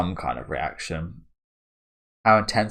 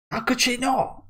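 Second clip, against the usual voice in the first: very high voice; lightly voiced shocked friend; mock incredulous. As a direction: squeak it in disbelief. Reading character's reply.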